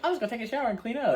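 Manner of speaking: high, rushed girlfriend imitation; high mock Nikki voice. Girlfriend brushing off her mess, high fast mock voice. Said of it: "And she's like".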